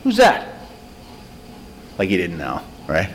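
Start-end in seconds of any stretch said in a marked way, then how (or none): 0.0-0.5 mock surprise. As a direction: play innocent, pitch jumping up. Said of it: "And Jesus went".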